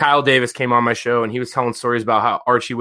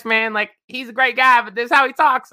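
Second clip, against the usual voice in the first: imitating a buddy's way of talking, much higher; affectionate higher-pitched impression of a teammate; London Warren's voice; 11 semitones higher than usual. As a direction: put on your friend's voice, pitched way up. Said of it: "he talks like this".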